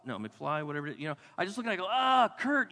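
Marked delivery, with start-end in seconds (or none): 1.9-2.7 higher, louder exasperated groan of his own name; acted burst of frustration at himself; exasperated self-reproach. Preacher looks at himself and groans in exasperation.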